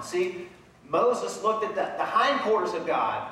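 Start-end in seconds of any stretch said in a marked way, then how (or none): none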